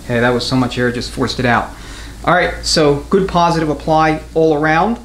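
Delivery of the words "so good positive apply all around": usual speaking voice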